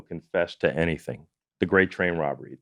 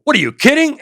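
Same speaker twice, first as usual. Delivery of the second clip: much higher and louder, an outraged disbelieving cry; shocked disbelief; 10 dB louder than usual. Retold or imitated. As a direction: retell the client's stunned outrage. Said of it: "And they go".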